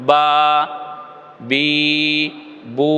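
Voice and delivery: বা, বি, বু each held one alif length; short vowel demo. Shown as a demonstration. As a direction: sound বা, বি, বু with a single-beat vowel. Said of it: "আমরা এক আলিফ টান দিলাম". (এক আলিফ টানে বা বি বু)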